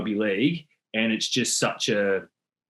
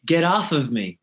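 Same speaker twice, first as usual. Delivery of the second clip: American accent, "Get off of me" with "of" added; 5 semitones higher than usual. Shown as a demonstration. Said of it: "But you guys say".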